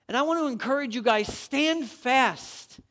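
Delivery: loud, high exhortation; full-force preaching. Acted. Pastor exhorting the congregation to stand fast, loud preaching. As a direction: drive every line home to the crowd.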